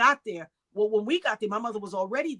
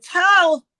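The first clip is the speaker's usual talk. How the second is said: loud, high retold cry of a name; urgent cry; 9 semitones higher, 10 dB louder than usual. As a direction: call out urgently to cut her off. Retold urgent plea to sister.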